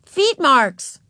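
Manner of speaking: put-on high, insistent little-girl voice; stubborn. A narrator's reading. Ramona insists on her own word, stubbornly. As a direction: dig in and refuse correction. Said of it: "said Ramona stubbornly".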